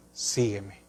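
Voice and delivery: a quiet, intimate invitation to follow; Jesus calling softly. Acted. Jesus' call to Mateo voiced. (Jesús llamando en voz baja y suave)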